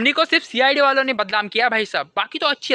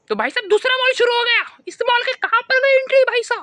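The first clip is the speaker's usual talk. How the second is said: much higher, louder cry of disbelief; high, loud comic outrage at getting lost; exasperated disbelief; 13 semitones higher, 3 dB louder than usual. Acted. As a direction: wail it like you can't believe it. Lost again, second mall starts.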